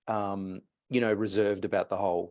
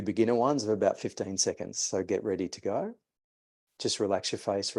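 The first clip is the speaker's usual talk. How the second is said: guided breathing instruction. Acted. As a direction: talk the listener gently through the exercise. Leads a fire-breath exercise.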